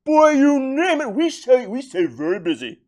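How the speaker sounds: put-on high, loud jolly old man; Santa Claus voice. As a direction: boom it out like Santa. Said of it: "the Santa Claus character".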